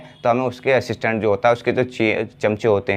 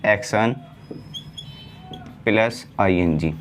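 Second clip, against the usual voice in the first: slow word-building demo; 4 semitones lower, 4 dB quieter, much slower than usual. Shown as a demonstration. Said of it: "He is".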